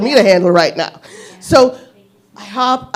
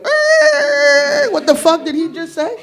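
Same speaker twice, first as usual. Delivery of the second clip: high squeaky disbelief; stunned inner voice; slower than usual. Retold or imitated. Comic baffled brain voice reacting to his line. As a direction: brain going what the. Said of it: "my brain went".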